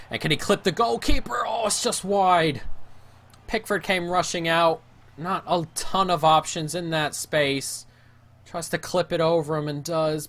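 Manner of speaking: rising thrill as the shot goes up; excited call. Real, not acted. Gamer watching a chip on goal, excited.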